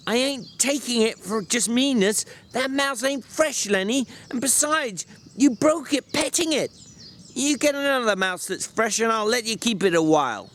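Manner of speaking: calm him down gently; softened George. A narrator's reading. George consoling Lenny about the dead mouse, softened.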